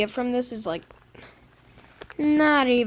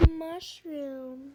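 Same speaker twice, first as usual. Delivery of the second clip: very soft, drawn-out word; hushed; 2 semitones higher, 14 dB quieter than usual. Pretend. Gamer picking up a second mushroom, hushed. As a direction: drop suddenly to a hush.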